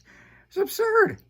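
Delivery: sudden high loud cry of disbelief; exasperated outburst. Real, not acted. Host ranting about the dance, exasperated outburst. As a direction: let absurd burst out high.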